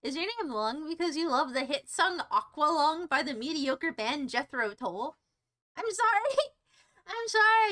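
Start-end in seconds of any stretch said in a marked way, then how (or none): 5.8-7.7 high-pitched mock apology; hammed-up high sorry; exaggerated apology. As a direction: plead it up high. Mock apology to insulted band's fans.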